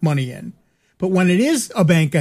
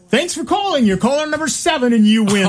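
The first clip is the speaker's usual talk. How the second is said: bright, high radio announcer hype; cheery radio DJ announcing a winner; radio contest promo; 7 semitones higher than usual. Pretend. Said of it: "Turn on the radio voice".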